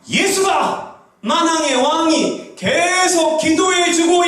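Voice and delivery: high, slow, impassioned preaching; fervent preaching. Acted. Pastor exhorting the congregation with high, fervent preaching. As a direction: preach it like a climax, pulling each word high.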